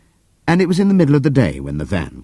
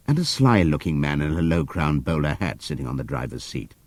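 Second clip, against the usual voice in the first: ordinary delivery, nothing marked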